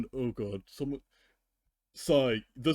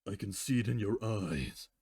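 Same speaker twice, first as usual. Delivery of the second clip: story reading with character voices; story narration; 3 semitones lower than usual. Acted. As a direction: narrate and voice the ponies by turns. Fanfic reading.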